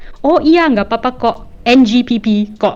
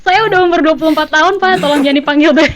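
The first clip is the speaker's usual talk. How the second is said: mock indignant correction; 7 semitones higher, faster than usual. Friend pretending to correct the security guard, mock indignant. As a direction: correct the guard with playful outrage. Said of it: "You're like".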